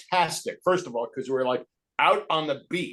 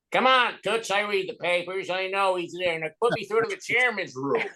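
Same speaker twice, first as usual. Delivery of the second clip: higher, breezy Bob Hope charm; Bob Hope impression; 4 semitones higher than usual. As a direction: sweet-talk the receptionist as Bob Hope. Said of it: "call the hospital as Bob Hope".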